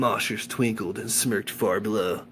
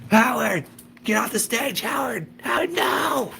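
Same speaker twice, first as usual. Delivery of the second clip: yelling at the dog; 12 semitones higher than usual. A narrator's reading. Voicing a bandmate yelling at the dog on stage, frantic high shout. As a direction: call the dog off in panic.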